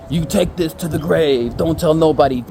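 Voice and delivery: slow, loud put-on warning voice; mock-solemn imitation of a warning; stern secrecy demand. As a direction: swear him to secrecy, slowly and heavily.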